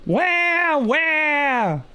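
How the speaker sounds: high, drawn-out pretend bawling; mock baby wail. Little brother bawling over his boo-boo, high mock wail. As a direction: wail like a hurt toddler, put on.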